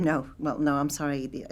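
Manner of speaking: ordinary talk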